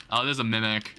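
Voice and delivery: ordinary delivery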